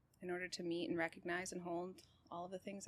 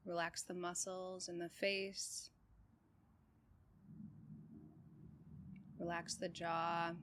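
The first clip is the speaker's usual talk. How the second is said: calm, even meditation guide with long pauses; unhurried body-scan voice, settled and steady; guided meditation; 2 semitones lower, 5 dB quieter than usual. Real, not acted. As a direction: guide them gently through breath and body, leaving space between phrases.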